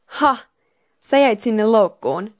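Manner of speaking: loud, drawn-out gloat; gloating exclamation. A narrator's reading. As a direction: crow slowly over a trapped rival. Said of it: "huudahti vahingon iloisena minulle". (vahingoniloinen huudahdus)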